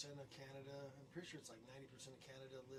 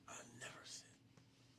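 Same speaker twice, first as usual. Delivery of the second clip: whispered, slow refusal; stubborn whisper; 4 dB quieter, much slower than usual. Pretend. Dared to say it, a slow stubborn whisper. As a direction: whisper it slowly, holding out.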